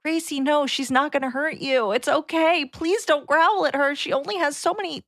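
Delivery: high, pleading coax; pleading with the dog. Retold or imitated. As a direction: soothe and beg the growling dog.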